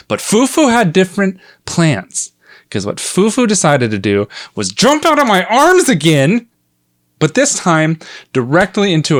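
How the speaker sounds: high-pitched storyteller's exclamation; exasperated outburst. Retold or imitated. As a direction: build to a high, incredulous cry.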